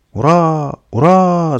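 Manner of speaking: loud, high, drawn-out hurrah; crowd cheer. A narrator's reading. Frightened nichevoki cheering their leader, loud crowd cheer. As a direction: shout it out long like a cheering crowd. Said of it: "закричали ничевоки".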